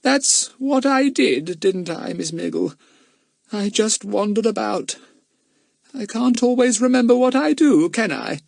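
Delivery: high, wavering-minded gentleman; bewildered, high-pitched character voice; confused Mister Smelly. Acted. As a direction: appeal for reassurance in a high voice.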